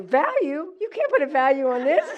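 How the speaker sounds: how dare you ask its value; outraged disbelief. Retold or imitated. Answering the officer's value question with outraged disbelief.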